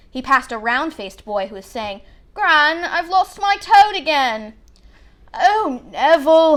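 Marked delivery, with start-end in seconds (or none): 2.3-4.5 put-on round-faced boy. A narrator's reading. Boy tells his gran lost toad. 5.3-6.6 put-on old woman sighs his name, a touch slow; weary grandmother sigh. A narrator's reading. Grandmother sighs at her forgetful grandson, weary. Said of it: "he heard the old woman sigh".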